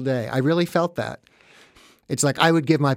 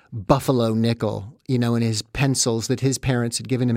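ordinary talk

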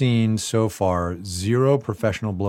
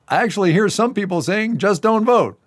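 raised, louder stump-speech quote; higher-pitched imitation of a speechmaker; mock politician's speech; 11 semitones higher, 4 dB louder than usual. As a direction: address a crowd with exaggerated projection. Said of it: "Pence gets off the plane and says".

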